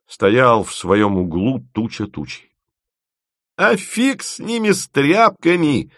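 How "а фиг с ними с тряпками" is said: very high, carefree cry; exclaiming. A narrator's reading. Bride waves away ruined clothes, exclaiming. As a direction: toss it out high and gleeful. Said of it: "воскликнула Тося".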